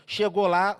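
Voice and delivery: ordinary talk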